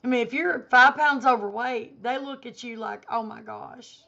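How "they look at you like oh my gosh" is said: ordinary delivery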